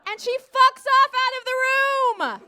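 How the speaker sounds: normal talking voice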